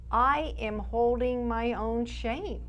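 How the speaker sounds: ordinary talk, nothing marked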